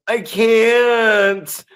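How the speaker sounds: high, pinched helpless voice; a kid who can't stop what he's doing; helpless whine. Retold or imitated. Sammy answering from back seat.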